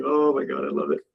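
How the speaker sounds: ordinary delivery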